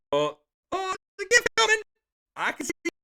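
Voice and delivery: very high, loud, breathless gift alert; excited squeal. Real, not acted. Streamer squeals as a viewer's gift arrives. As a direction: squeal about the incoming gift.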